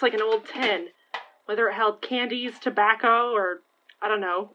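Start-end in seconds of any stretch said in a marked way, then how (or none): none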